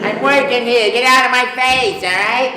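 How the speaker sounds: high, loud Brooklyn tirade; Brooklyn Italian plumber. Shown as a demonstration. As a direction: yell at them like an annoyed Brooklyn plumber. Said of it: "Italian plumber from Brooklyn, what is that voice like?".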